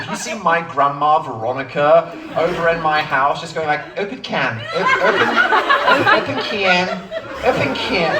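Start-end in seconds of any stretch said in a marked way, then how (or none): 4.0-8.1 imitating grandma's American accent. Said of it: "say it in an offensive American accent".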